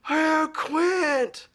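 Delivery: high, loud call across a crowd. Retold or imitated. Friend spotting him in the convention crowd, calling out high and loud.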